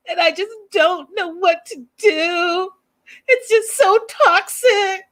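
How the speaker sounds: hammed-up sobbing imitation; mock crying. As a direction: exaggerate her tearful confession. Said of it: "she starts crying again".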